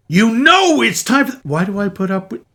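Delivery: high, loud fed-up pleading; venting at an annoying partner; exasperated. Acted.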